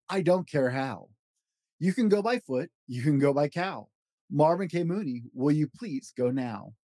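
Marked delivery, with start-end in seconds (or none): none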